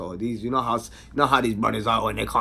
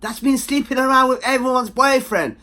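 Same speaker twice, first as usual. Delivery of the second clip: mock hostile girls; 12 semitones higher, 8 dB louder than usual. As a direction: play the girls squaring up. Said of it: "they see my girl and say".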